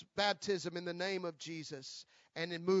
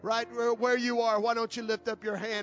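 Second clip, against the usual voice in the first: louder preaching plea; fervent prayer call; 3 semitones higher, 5 dB louder than usual. Acted. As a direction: lift them into prayer.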